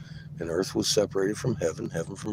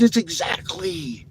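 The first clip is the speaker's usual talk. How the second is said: slow, high, amazed; stunned slow exclamation; awestruck; 8 semitones higher, 4 dB louder, slower than usual. Retold or imitated. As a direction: draw it out in disbelief. Retold stunned realization.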